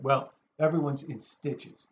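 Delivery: ordinary talk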